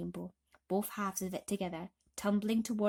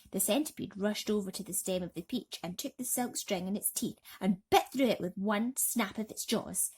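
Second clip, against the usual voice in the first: ordinary delivery, nothing marked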